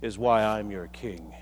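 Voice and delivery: low, slow mock-regal pronouncement; King Arthur. Arthur ends his speech as the light fades, low and solemn. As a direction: drop low and pause weightily after the first word. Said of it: "and he says".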